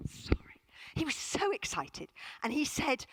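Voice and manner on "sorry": whispered apology. Real, not acted. Apology repeated, second take whispered.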